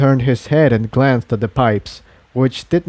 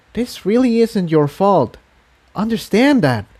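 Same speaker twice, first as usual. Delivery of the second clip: reassuring; 7 semitones higher than usual. A narrator's reading. Tiptoe reassures.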